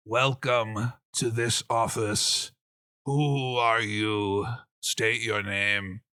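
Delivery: put-on very slow, drawn-out old giant; ponderous, formal old man; withered old giant. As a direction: stretch each word like a frail ancient official. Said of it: "the giant man kind of".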